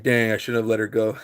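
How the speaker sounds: normal talking voice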